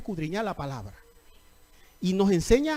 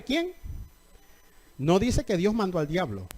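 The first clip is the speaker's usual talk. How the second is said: ordinary talk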